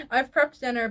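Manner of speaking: usual speaking voice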